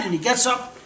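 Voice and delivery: usual speaking voice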